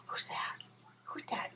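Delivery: soft breathy whisper to the dog. Real, not acted. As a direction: hush it to the puppy. Talking to dog, whispered greeting.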